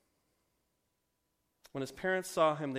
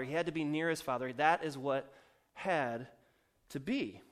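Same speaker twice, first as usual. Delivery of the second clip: normal talking voice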